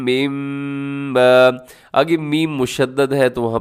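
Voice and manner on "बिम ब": बिम ब held long through the nose; बिम ब with a long ghunna; nasal ghunna. Shown as a demonstration. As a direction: hum बिम into ब slowly. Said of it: "गुन्ने के साथ आपने पढ़ना है".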